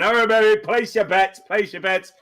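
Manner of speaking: dealer calling bets. Acted. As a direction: rattle the dice and rally the table. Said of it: "starts shaking 'em around".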